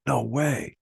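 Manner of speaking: "No way" exclaimed high. Shown as a demonstration. Teacher acting out the plain expression, surprised. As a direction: gasp out "No way" in disbelief. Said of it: "the expression "no way".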